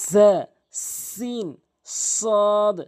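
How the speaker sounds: জা, সিন, সদ each drawn out slowly with the tongue tip between the teeth; teacher sounding out জা, সিন, সদ with careful Arabic articulation; Arabic letter demo. Shown as a demonstration. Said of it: "এভাবে চেষ্টা করবেন".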